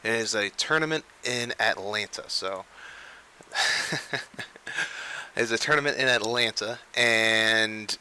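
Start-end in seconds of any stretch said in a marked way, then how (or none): 3.5-5.3 breathy, voiceless chuckle; real chuckle breaking into the talk; laughing. Real, not acted. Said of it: "I was laughing".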